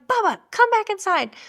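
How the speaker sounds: high-pitched call to a runaway dog; yelling at the dog. Retold or imitated. Owner yelling after her runaway dog, calling high. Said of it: "I'm yelling at him".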